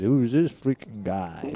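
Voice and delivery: slow, lightly put-on American accent; a hint of a Welshman doing American, drawn out; American accent impression. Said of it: "His American accent was mental".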